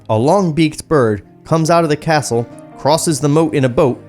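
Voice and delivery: normal talking voice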